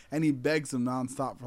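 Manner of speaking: ordinary talk, nothing marked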